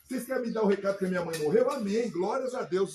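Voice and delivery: retold loud amen over bad news; loud defiant praise. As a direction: proclaim it loudly, then drag the praise. Retold answer about mother's death.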